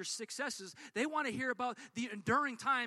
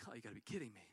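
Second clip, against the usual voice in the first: much lower, softer whiny grumble; exasperated driver; 11 semitones lower, 13 dB quieter, faster than usual. Retold or imitated. Preacher voicing the pulled-over driver, low and whiny. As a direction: groan it low like a fed-up driver. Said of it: "And he's like".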